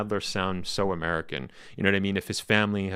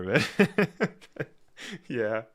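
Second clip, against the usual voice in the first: ordinary talk, nothing marked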